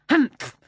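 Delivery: loud offended snort; huffy humph. A narrator's reading. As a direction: huff it out, wounded. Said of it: "he said, blowing his nose".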